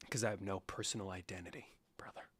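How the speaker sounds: low unvoiced whisper; a hushed, deadpan self-mocking aside. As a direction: breathe the joke in a whisper. Self-mocking reply to a compliment.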